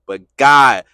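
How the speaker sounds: low-pitched, forceful interruption; insistent protest. Acted. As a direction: push the objection out over the hushed answer. Believer protesting more forcefully.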